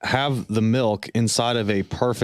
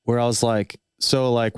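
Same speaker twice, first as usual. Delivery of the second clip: normal talking voice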